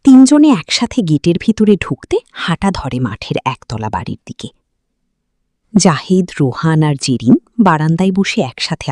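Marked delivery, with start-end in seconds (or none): none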